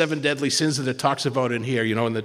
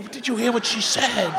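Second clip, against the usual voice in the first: laughing excited; 10 semitones higher than usual. Retold or imitated. Retelling excited exchange.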